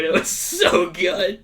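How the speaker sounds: slow, high, loud relish; savoring. Real, not acted. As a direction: stretch it out, loving the memory. Relishing the memory.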